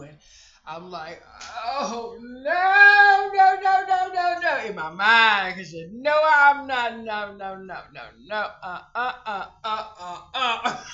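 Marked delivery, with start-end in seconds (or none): 1.7-10.8 high, loud panicked no-no-no wail; a frantic, rising chant of no. Retold or imitated. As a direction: cry out in alarm, pitch soaring. Panicked cry at daughter's words.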